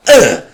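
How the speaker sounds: loud grossed-out groan; sharp groan of disgust at the dog; disgusted ugh. Retold or imitated. Reacting to dog humping leg.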